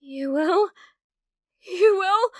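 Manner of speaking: light up with disbelieving joy; ecstatic, pitch soaring on the second; overjoyed disbelief. Acted. Viewer agrees to stay.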